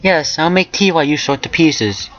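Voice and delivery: put-on high, polite girl's voice; high-voiced agreeable answer; character reply. A narrator's reading. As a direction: pitch it up, courteous and easy.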